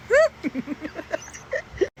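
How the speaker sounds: shrill burst of giggling; high squealing laugh. Real, not acted. Laughing at the chat's cheesy line with a high squeal. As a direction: squeal with delight at the joke.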